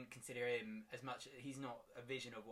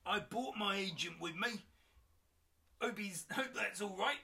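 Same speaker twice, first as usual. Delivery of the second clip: high, loud cockney lad; cheerful cockney player; 8 semitones higher, 7 dB louder than usual. Acted. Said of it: "Kyle is a bit cockney".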